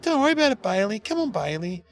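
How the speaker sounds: put-on calm him down like a girlfriend would; mock high-pitched girlfriend calming her partner; soothing girlfriend. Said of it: "his girlfriend comes and goes".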